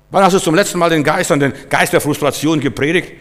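usual speaking voice

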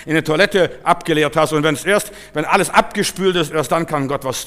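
loud, high, insistent sermon delivery; forceful preaching. Real, not acted. Preacher urges faith over medicines, forceful preaching.

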